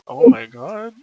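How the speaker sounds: mock gasp. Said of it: "I was gonna be like".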